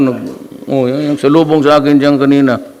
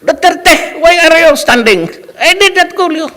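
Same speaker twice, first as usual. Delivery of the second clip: much higher put-on voice of a fussy law professor; mock professor; 3 dB louder than usual. Professor scolding two students standing in class, high mocking impersonation. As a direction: play the strict professor in a high, slowed mocking voice.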